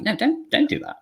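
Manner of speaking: high, pleading "don't do that"; mock plea. Voicing a fan telling haters to stop, high and pleading. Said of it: "You're like".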